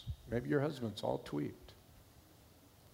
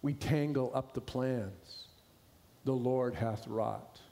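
poem recitation; 3 semitones lower than usual. Acted. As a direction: let each line fall gently, unhurried. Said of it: "It goes like this".

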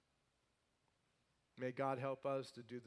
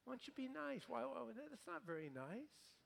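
high, soft mock-whiny protest; lightly mocking a offended listener's whine; whiny complaint; 9 semitones higher, 8 dB quieter than usual. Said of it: "You go".

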